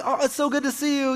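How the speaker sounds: high, chirpy family hello; relative greeting. Retold or imitated. Relative on a Christmas FaceTime call, high cheerful hello. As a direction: greet him brightly on the call.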